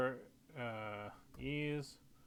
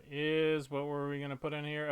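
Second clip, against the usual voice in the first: usual speaking voice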